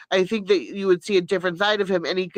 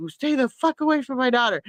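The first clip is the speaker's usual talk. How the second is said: higher, mock-furious warning; retold angry warning; 8 semitones higher than usual. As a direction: replay the warning to the troll, pitched up. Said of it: "I was like".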